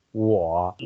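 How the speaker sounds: 我 with a dipping tone; third tone. Shown as a demonstration. Teacher showing a listener the pronunciation, 我 in dipping third tone. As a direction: draw out 我, falling low then rising. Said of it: "三声".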